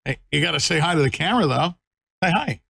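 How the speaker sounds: high, quick coaxing puppy talk. Real, not acted. Coaxing puppy to greet camera.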